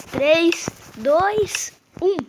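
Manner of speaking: loud countdown, voice climbing; excited countdown. Real, not acted. Player counting down the last seconds before the drop, excited. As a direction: call the count out with rising excitement.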